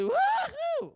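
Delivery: high, slow whooping cheer; cheering woo-hoo. Real, not acted. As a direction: whoop it like a rowdy fan. Crowd locals cheering.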